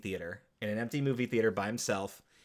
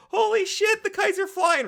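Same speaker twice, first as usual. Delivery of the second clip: high, loud mock scream; a hammed-up shriek of joy; screaming back; 9 dB louder than usual. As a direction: yell it out in wild excitement. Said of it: "as [name] is screaming back".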